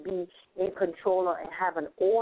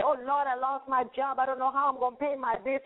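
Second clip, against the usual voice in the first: higher, imitating a frantic worrier; mock panic; 6 semitones higher than usual. Someone who just lost their job, mock freaking out. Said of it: "Rather than freaking out and say".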